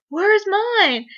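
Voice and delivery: high-pitched plaintive question, imitated; mock demanding. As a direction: ask like someone playfully left out. Acted actor demanding his picture.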